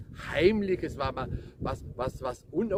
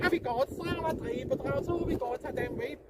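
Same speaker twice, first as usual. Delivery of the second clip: much higher, prying busybody voice; nosy neighbor woman; 12 semitones higher than usual. Retold or imitated. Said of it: "man wird ausgefragt von der Nachbarin".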